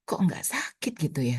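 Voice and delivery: hushed, breathy wonder at the painless death; a soft unvoiced whisper of amazement. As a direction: whisper it in awe. Recalling dying, quiet inner words.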